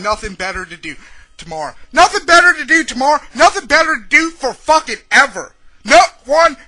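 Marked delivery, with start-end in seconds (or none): 1.9-3.2 incredulous angry echo, louder, higher and fast; angry retort. Real, not acted. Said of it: "this is gonna be a fucking angry". 3.3-6.6 raised, higher, indignant voice; angry rant. Real, not acted. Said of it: "this is gonna be a fucking angry".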